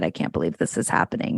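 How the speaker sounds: breathless panicked whisper; whispered freak-out at a teen's news. Retold or imitated. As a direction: mutter it in low-voiced panic. Parent panicking, voiced in talk.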